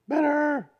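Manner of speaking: high, ringing repeat of better; Better soaring up, ecstatic; excited echo. Acted. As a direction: sing out the word better with joy. Echoed refrain, lifted higher.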